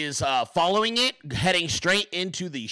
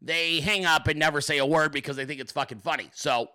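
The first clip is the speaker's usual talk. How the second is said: normal talking voice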